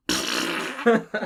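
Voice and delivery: loud mouth-made fart noise; imitated vicious fart. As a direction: blow a rude, vicious raspberry. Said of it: "like, a vicious, like".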